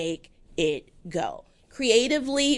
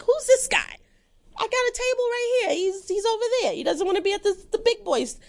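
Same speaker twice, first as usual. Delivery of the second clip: put-on much higher Italian-American accent, tough-guy swagger; Italian-American mobster accent, high and cocky; Joe Pesci Italian accent; 12 semitones higher than usual. Guest voices a rival at the table in an Italian-American accent. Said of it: "Why did I do an Italian accent?".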